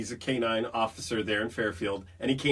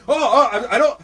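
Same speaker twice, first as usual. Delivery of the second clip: high, loud stammering fright; yelping, flustered recoil; startled panic; 10 semitones higher, 10 dB louder than usual. Retold or imitated. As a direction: replay a jolt of terror at a lunging dog. Acting out his fright at dog.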